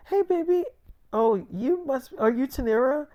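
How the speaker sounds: high-pitched friendly hello; sweet greeting. Acted. Jenna greeting a newcomer.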